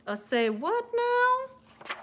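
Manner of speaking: high, slow puzzled question. Acted. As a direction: sound baffled by the word. Puzzled comment.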